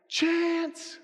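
high, held calling-out; excited high call, vowel stretched; boy calling dog. Acted. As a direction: call a far-off dog by name. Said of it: "the little boy hears a dog barking".

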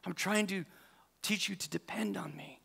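hushed whisper of God. Acted. Preacher whispering God's gentle words. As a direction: breathe it softly, intimate.

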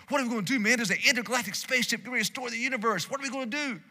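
high, fast, frantic movie-hero voice; Will Smith panic. Retold or imitated. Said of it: "Will Smith is like".

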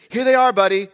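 high, loud playful announcement; dad showing his son the kiwis on the counter; triumphant reveal. Retold or imitated. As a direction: present it with a flourish.

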